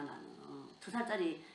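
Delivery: normal talking voice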